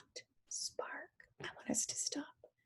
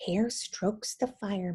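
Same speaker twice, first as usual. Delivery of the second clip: ordinary delivery, nothing marked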